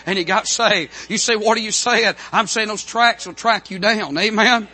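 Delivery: high, loud revival preacher; full-force preaching. Real, not acted. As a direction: drive each phrase home, loud and high-pitched.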